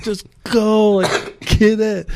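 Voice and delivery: a high, loud cheer, willing him to the line; excited cheering. Retold or imitated. Cheering rider down final section.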